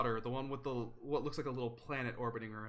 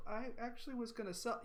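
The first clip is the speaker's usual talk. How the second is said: small, higher and softer protest; sheepish protest; 8 semitones higher, 6 dB quieter, faster than usual. Acted. Gwen objecting to her mother, small sheepish protest. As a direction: murmur your objection, higher pitched.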